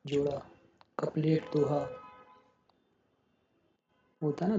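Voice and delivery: ordinary delivery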